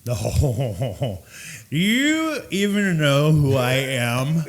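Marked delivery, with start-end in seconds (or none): 1.7-4.4 mock a self-important drunk with big swooping emphasis; drunk customer. Super intoxicated customer challenging the bartender, loud drunken indignation. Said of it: "the super intoxicated".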